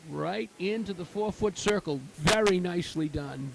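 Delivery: ordinary delivery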